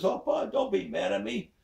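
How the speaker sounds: put-on high pleading girl voice; pleading daughter. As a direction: beg him not to be cross. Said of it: "She says".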